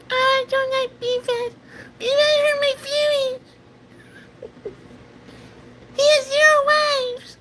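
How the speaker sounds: put-on very high squeaky baby voice; tiny whining falsetto squeal; mock baby squeak, pitched far up; best Goku. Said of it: "your little baby voice".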